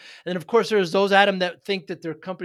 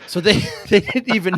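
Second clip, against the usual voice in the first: laughing; 3 semitones higher, 8 dB louder than usual. Real, not acted. Laughing at client's answer.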